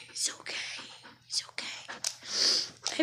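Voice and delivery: breath-only comforting whisper, drawn out on the repeat; tender, voiceless hush; whispered reassurance. Real, not acted. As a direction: whisper it slowly to calm someone upset. Soothing her crying dog.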